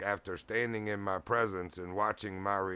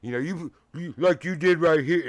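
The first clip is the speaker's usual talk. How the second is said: raised voice, higher and louder, imitating an upset man; mock-agitated loud telling-off; retold agitated complaint; 6 semitones higher, 10 dB louder than usual. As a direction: scold a stranger, tripping over "you". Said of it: "he said it just like that".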